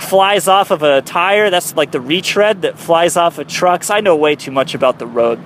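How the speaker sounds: ordinary talk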